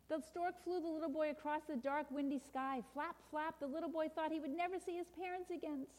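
high, plaintive storybook narration; hushed story reading. A narrator's reading. Storyteller tells of boy carried away, plaintive and hushed. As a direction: read it softly for the lost boy. Reads a children's story aloud.

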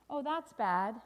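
loud, sorry exclamation; dismayed refrain. Acted. Storyteller reacts to crying boy, emphatic dismay. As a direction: lament the bad news.

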